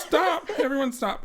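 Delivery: high plea through laughter; half-laughing call for a stop; giggly plea. Real, not acted. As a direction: beg for order while still giggling. Begging others to stop laughing.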